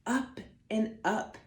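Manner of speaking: normal talking voice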